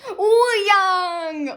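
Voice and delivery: loud, high, drawn-out squeal of a name; excited cry. Real, not acted. Fan revealing the next pull, loud excited cry. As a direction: holler the name in delight. Calls out a card she pulled.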